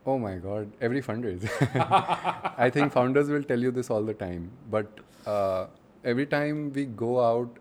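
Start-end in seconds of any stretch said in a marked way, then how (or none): 1.5-2.5 real laugh. Real, not acted. Laughs at the question.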